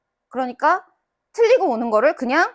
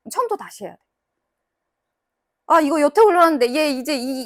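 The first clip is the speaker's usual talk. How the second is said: ordinary talk, nothing marked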